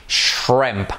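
ordinary talk

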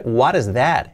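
"what" ending in a light tapped R sound; T as soft R. Shown as a demonstration. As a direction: flap the T of "what" into "is". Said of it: "la T como una R suave".

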